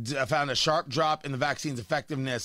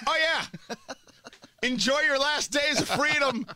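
laughing; 9 semitones higher than usual. Real, not acted. Mock ominous laughing farewell.

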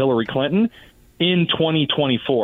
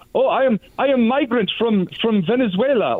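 mock Arabic accent, pitched up; 7 semitones higher than usual. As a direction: put on a thick Arabic accent. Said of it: "says in an Arabic accent".

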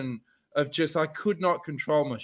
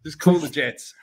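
exasperated retort; 5 dB louder than usual. Retold or imitated. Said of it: "And I go".

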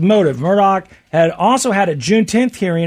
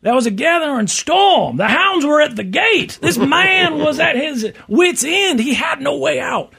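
high, slow, booming Southern lawyer; honeyed southern lawyer drawl; 11 semitones higher, slower than usual. Pretend. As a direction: preach it to the jury, slow. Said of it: "you gotta give that big Southern".

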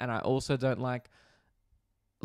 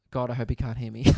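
ordinary delivery

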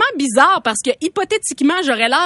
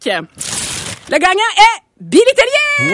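hyped award announcement; 8 semitones higher, much slower than usual. Pretend. Mock awards host reveals winner.

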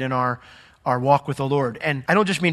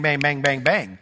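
ordinary delivery

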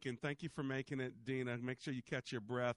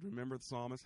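ordinary talk

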